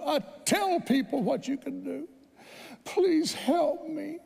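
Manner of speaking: high, tearful plea to God, slowing; a desperate mother crying out, high and tearful; pleading prayer. Retold or imitated. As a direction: beg God for help, voice near breaking.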